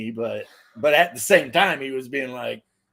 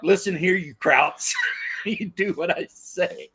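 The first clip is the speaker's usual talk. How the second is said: mock bossy Trump; 4 semitones higher than usual. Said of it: "Now he's all like".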